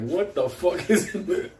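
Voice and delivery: ordinary delivery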